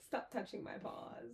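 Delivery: quiet, hushed pet voice; dog's pretend complaint. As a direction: grumble softly as the fussed-over dog. Co-host joins in voicing dog.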